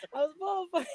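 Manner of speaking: laughing through the words, voice high. Real, not acted. Guest laughing at her own boast, giggling. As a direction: breaks into giggles mid-line.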